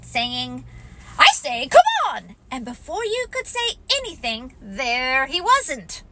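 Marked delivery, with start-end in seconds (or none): 1.2-2.3 high, loud bouncy shout; calling out. Acted. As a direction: call them on with boisterous energy. Tigger calls to the others.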